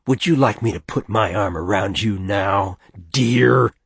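mock-sweet taunt, leaning loudly on "dear"; mincing, nasty. Father mocking his wife's wish, mincing nasty voice. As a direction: mimic affection with sneering daintiness. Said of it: "his mincing, nasty voice".

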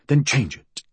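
understated anger, telling oneself off; angry. A narrator's reading. Fool tells himself to change it, mildly angry. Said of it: "he told himself angrily".

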